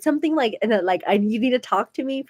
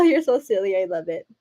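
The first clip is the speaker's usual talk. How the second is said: ordinary talk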